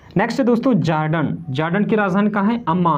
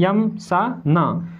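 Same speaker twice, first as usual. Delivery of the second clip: slow, drawn-out mnemonic; much slower than usual. Shown as a demonstration. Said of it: "slow mnemonic repeat".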